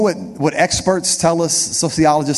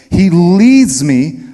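"leads" held long and punched loud, slow; emphasis on leads; 6 dB louder, much slower than usual. Shown as a demonstration. Preacher stressing "leads" for the congregation. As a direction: lean hard on "leads" as the underlined word. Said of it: "every time I got a word underlined".

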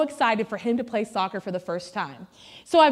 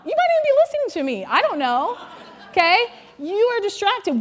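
teasing the congregation; 7 semitones higher than usual. Real, not acted.

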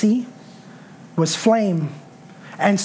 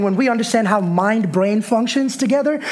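ordinary delivery

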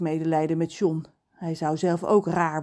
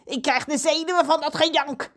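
crowing caw; 10 semitones higher than usual. Acted. Crow squawks to be let go. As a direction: croak it like an impatient crow. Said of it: "krast hij".

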